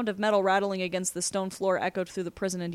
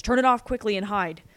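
raised, urgent order; urgent warning; 3 semitones higher, 7 dB louder than usual. Acted. Prisoner warning the boy to hide, urgently. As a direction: warn with a touch of alarm, louder.

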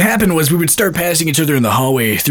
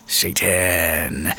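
lower, softer echo; quiet; 9 semitones lower, 7 dB quieter than usual. Retold or imitated. Friend murmuring Satan back in class. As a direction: murmur it low. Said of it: "And he went".

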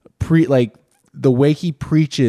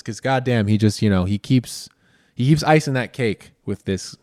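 ordinary talk, nothing marked

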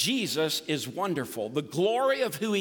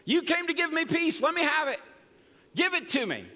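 just talking to God, pitched up and eager; simple heartfelt prayer; 6 semitones higher than usual. Shown as a demonstration. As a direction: pray to Jesus like talking to a friend. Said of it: "it's really this simple".

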